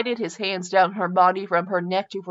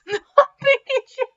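high laugh; 3 dB louder than usual. Real, not acted. Breaks up laughing reading.

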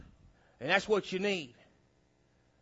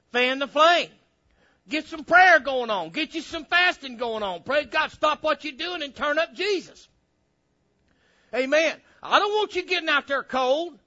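raised, punchy exhortation; fired-up preaching; 8 semitones higher, 7 dB louder than usual. Real, not acted. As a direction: urge the congregation on with rising force. Preaches at full force.

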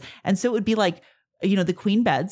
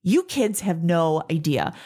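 usual speaking voice

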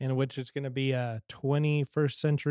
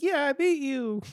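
little sister voice; 11 semitones higher than usual. Retold or imitated. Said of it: "she's like".

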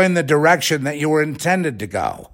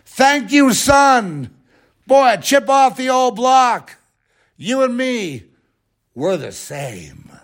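mocking a proud father, much higher; 12 semitones higher, slower than usual. Mocking [name]'s admiral father, much higher. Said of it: "what does Daddy, the Admiral, say?".

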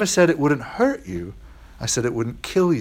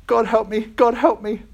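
whimpering; 7 semitones higher than usual. Acted. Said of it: "but ended whimpering".

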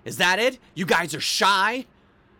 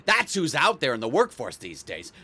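normal talking voice

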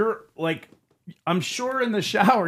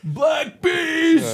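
high shouted nickname; shouting; 9 semitones higher, 3 dB louder than usual. Retold or imitated. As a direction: yell it like a fan. Quoting the scream.

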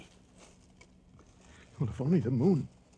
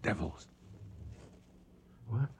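usual speaking voice

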